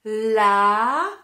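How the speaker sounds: lapin split as la-pin, loud and even; syllables beaten out. Shown as a demonstration. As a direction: clap out each syllable of lapin. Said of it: "Je vérifie en comptant cette fois".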